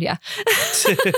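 a high yeah that breaks into a laugh. Real, not acted. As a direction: laugh the word out. Laughing after working it out.

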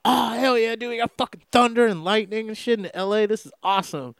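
excited. Retold or imitated. Quoting own excitement.

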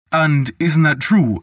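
a touch of disbelief in the voice; astonished. A narrator's reading. Michael, caught out by Peter, asks in astonishment.

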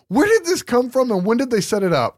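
high-pitched baffled questioning; comic indignant bewilderment; exasperated disbelief. Real, not acted. Baffled complaint about the film's plot.

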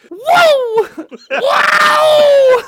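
comic shriek of alarm; high panicked yelp. Acted. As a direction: yelp as the fish flies off. Shaking a fish off the line.

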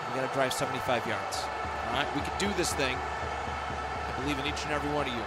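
rally the team, pumped up; a quarterback hyping his huddle. Acted. Acted football huddle skit.

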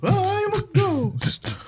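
high, slow, wailing ghost. Pretend. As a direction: wail it like a ghost. Spooky ghost singing.

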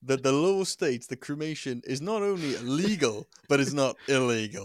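loud mock presenter question; hammed-up TV host; mock Clarkson. Clarkson parody about cremation law.

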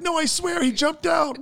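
very high mock pleading; tearful protest. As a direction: imitate a crying sister insisting. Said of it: "she's, like, crying".

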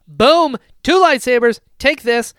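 loud, high, punchy; excited battle cry. Acted. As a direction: shout it like the fight is on. Acting out the battle.

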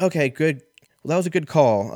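bright phone-call impression; mock caller voice. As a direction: play the overly upbeat caller. Acting out a caller's voice.

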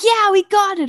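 high, loud happy shout; excited cheer. Retold or imitated. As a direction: cheer like you just won. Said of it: "screams of".

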